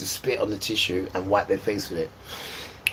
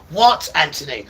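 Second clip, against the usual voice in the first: raised, higher mock mum calling back; mum answering; 6 semitones higher, 7 dB louder than usual. Mother answering her son, a hint of a sharp shout. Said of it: "That's how she used to be".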